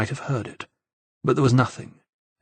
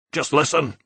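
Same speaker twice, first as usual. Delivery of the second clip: insistent; 4 semitones higher than usual. A narrator's reading. Scottish friend insisting Enzo listen before bad news, firmly. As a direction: make him stop and listen. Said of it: "Simon's voice was insistent".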